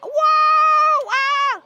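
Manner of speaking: loud, high whoop of delight; thrilled shout. Acted. Kid on the fast merry-go-round, shouting high with glee. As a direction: scream with joy as the ride spins.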